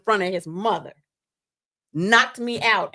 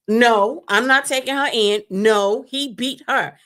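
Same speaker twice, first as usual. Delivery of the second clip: firm cop refusal; 4 semitones higher, 6 dB louder than usual. Retold or imitated. Quoting cop defending her.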